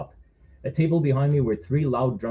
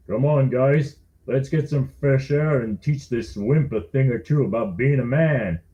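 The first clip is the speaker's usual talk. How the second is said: slow, louder tough guy; calm threatening leader; menacing bully; 2 semitones higher, 4 dB louder, slower than usual. Acted. Silent big guy speaks.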